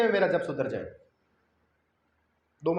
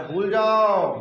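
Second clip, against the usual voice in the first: loud drawn-out holler; mock yell; 2 semitones higher, 9 dB louder, much slower than usual. Teacher mocking oblivious chanter as snake appears, mock yell. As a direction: belt it out long, as a mock command. Mocks forgetting all despite snake.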